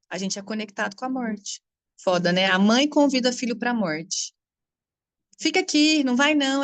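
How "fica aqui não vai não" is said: 1 anxious mother. Retold or imitated. Mother voiced keeping child home.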